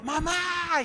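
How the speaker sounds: loud high cry; the lost boy answering his mother; child calling back. Retold or imitated. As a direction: shout back in relief. Boy answers across museum.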